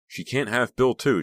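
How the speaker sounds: high, loud angry wail; shriek. A narrator's reading. Becca outside the window, shrieking in rage. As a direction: shriek it into the wind. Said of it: "she shrieked".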